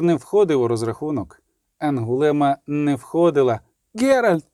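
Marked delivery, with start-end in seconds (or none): 3.9-4.4 urgent, high-pitched call of a name; high cry. A narrator's reading. Girl calling the witcher in battle, high cry. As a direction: cry out for attention. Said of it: "крикнула Ангулема".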